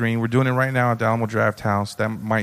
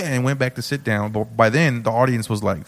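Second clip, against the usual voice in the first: ordinary delivery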